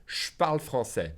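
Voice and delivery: "Je parle" run together as "shparle"; blended sh. Shown as a demonstration. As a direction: colloquial "Je parle", no E, J turned to sh. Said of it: "blend the P and the J together into sh".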